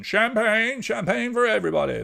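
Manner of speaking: a quick sketch of Bubbles toasting everyone; Bubbles impression. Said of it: "You remember Bubbles from Little Britain".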